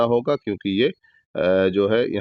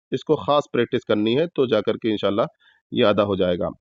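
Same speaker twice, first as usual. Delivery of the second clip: normal talking voice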